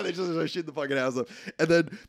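ordinary talk

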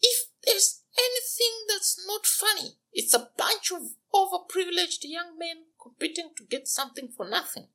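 very high, scornful princess voice; cartoon princess. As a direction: sneer at the suitors. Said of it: "the voice of a princess".

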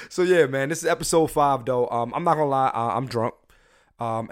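ordinary talk

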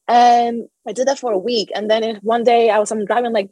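ordinary talk, nothing marked

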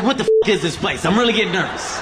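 ordinary talk

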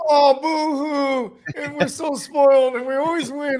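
high, slow whiny boo-hoo; mock crying. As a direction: sob sarcastically like a spoiled fan. Mocking spoiled Seahawks fans.

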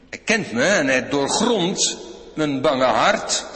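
normal talking voice

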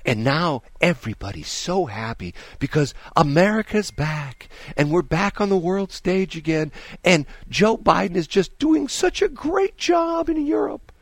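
sarcastic parody of cheerleading anchors; mocking.

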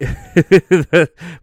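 big real laugh at himself; hearty laugh. Real, not acted. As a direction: burst out laughing. Laughs at own joke.

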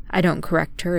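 plain reading voice

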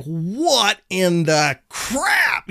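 slow, drawn-out exasperated outburst; What in the crap, dragged out slowly in disbelief. Retold or imitated. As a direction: stretch it out in disbelief. Finding the emergency brake on.

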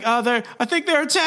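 high, fast flustered stammer; mock nervous pleading. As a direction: act out a flustered suspect explaining. Peter pleading with panicked cops.

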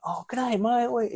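high, hammed-up Australian "g'day, mate"; exaggerated Aussie greeting, mocking how some Australians lay it on; full Aussie accent. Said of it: "they get full Aussie".

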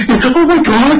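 high-pitched imitation of panicked snivelling; hammed-up horror-film heroine; mock terrified girl. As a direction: mimic a frightened girl on camera. Mimics film's snivelling, terrified girl.

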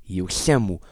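ordinary delivery

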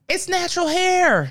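high, drawn-out insistence; exasperated, high-pitched plea; emphatic protest. Real, not acted. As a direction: stretch it out high, as if it should be obvious. Exasperated defence of natural hair.

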